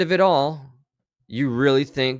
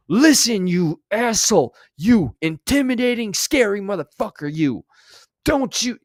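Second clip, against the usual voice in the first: high, loud, squeaky fake threat; mock tough guy; 8 semitones higher, 4 dB louder than usual. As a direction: play a tough guy who sounds anything but. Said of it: "the tone doesn't match the words".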